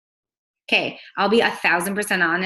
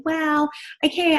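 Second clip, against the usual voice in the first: much higher, slow, unsure drawn-out voice; imitating hesitant Teresa; 9 semitones higher, slower than usual. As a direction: drag it out like a nervous recruiter. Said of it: "She was like".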